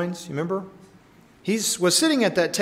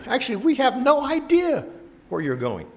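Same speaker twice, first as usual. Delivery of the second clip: much higher, strained retort; strong exasperation; 9 semitones higher than usual. Retold or imitated. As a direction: push back hard, baffled. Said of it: "strong saying".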